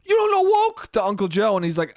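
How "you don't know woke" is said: very high, loud disbelieving yell; mock incredulous shout. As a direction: shout it at Uncle Joe in disbelief. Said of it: "Uncle Mario goes".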